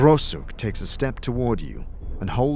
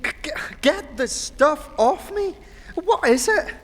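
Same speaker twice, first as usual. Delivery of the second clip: much higher squeaky disgusted voice; squeamish companion; slower than usual. Acted. Companion begging Chief to remove the gore, squeamish. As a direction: squeal for help with the goo.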